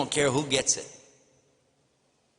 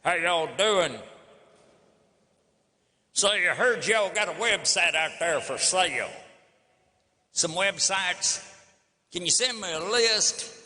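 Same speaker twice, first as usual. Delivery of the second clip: mock Texan drawl; 3 semitones higher than usual. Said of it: "I can recognize that accent".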